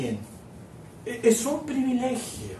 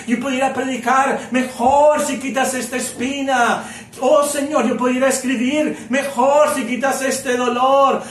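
louder, desperate begging to the Lord; preacher voicing Paul's tearful prayer, louder and urgent; anguished pleading prayer; 3 semitones higher, 5 dB louder than usual. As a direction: plead like a man on his knees in pain. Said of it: "llorando con mucho dolor".

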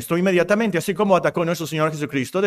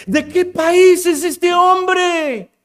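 much higher, louder, very slow; astonished exclamation; 12 semitones higher, 5 dB louder, slower than usual. Retold or imitated. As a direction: marvel loudly at the man.

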